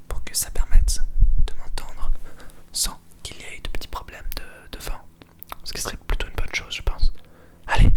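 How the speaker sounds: ASMR whisper. Shown as a demonstration. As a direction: close whisper in your ear. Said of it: "un A S M R".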